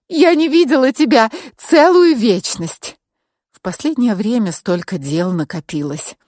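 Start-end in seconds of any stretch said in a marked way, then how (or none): 0.1-3.0 high-pitched exclamation, part amused, part bitter; laughter and bitterness. A narrator's reading. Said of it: "В её голосе звучали и смех, и горечь". (высоко, со смехом и горечью в голосе)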